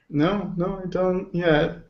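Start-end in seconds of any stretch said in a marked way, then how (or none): none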